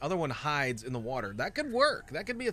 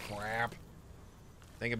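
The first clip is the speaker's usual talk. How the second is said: usual speaking voice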